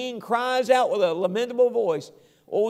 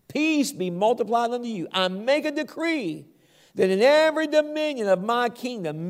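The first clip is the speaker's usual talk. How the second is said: royal decree; 3 semitones higher, 4 dB louder than usual. Acted. King proclaims his decree to all people, ringing and formal.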